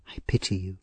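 a soft whispered pity. A narrator's reading. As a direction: whisper it gently to her. Rose comforting weeping Nancy.

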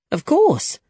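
very high-pitched, emphatic reply; eager assent. A narrator's reading. Young man assures Diego he remembers, eager and high. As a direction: jump up high with keen certainty.

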